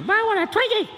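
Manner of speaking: put-on high-pitched child's demand; whiny little kid. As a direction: whine it like a small child. Kid insisting.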